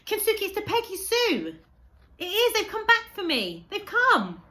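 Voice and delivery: very high, very loud ecstatic yells; boy shouting to his friend that his family has come back. Acted. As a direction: scream it out with overwhelming joy.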